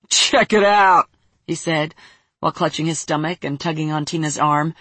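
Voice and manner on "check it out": slow, laughing loud call. Acted. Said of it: "burst out laughing".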